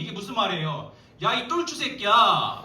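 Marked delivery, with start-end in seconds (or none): 1.2-2.6 insulting yell. Retold or imitated. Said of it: "말하면서".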